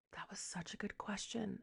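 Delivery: hushed, amazed whisper; awed whisper. Retold or imitated. Wife marvelling at her husband's clever question, in an awed whisper. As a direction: whisper it in quiet admiration.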